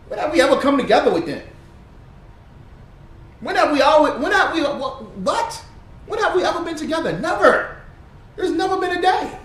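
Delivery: high, loud, fast, laughing rant; heated rant. Real, not acted. Ranting at the camera, then laughing. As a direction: fire off the questions fast.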